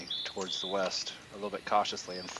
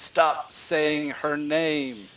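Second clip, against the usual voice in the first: agitated, drawn-out higher cry; slow, loud, raised-pitch protest from an agitated raven; 6 semitones higher, 5 dB louder, much slower than usual. Acted. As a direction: cry it out slowly, high and agitated. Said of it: "agitated and says".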